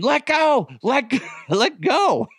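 high-pitched yell of "let go". Retold or imitated. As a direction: yell it frantically, voice jumping high. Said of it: "I'm just yelling".

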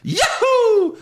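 very high, loud Mario yahoo. Pretend. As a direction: squeal it like Mario jumping. Mario's cheer imitated.

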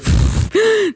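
high bright laugh; burst of laughter. Real, not acted. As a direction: laugh out loud. Laughs at grossir.

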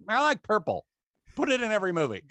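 high-pitched comic star impersonation; Sam Jackson. Retold or imitated. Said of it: "Sam Jackson's just like".

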